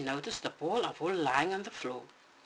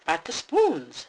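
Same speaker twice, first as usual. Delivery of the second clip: Mama Bear; 8 dB louder, slower than usual. Acted. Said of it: "said Mama".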